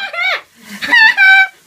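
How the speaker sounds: high shrieking laugh from the cold. Real, not acted. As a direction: squeal with laughter, freezing. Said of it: "好凍啊！".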